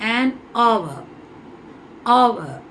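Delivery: "hour" as "aw-va", vowel first; silent h. Shown as a demonstration. Teacher showing h is silent in hour, firm vowel onset. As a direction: say "An hour", then "hour" alone, without any h. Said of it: "ஹெச் இது எல்லாத்துலயுமே silent".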